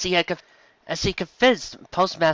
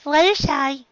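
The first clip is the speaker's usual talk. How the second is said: high call of her name; 13 semitones higher, 4 dB louder, slower than usual. A narrator's reading. Dizzy calling.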